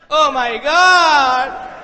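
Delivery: loud, long-drawn wail of dismay; dismayed outcry. Retold or imitated. Learning he lacks a sponsor, loud dismay. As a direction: stretch it out in horrified realisation.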